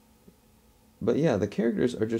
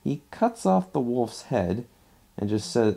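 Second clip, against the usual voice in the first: ordinary talk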